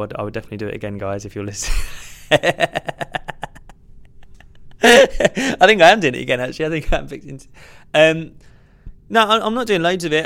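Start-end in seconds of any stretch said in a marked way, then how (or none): 1.8-4.3 breathy laugh. Real, not acted. Laughs at own joke. 4.8-10.3 high, loud, laughing backpedal; flustered laughing. Real, not acted.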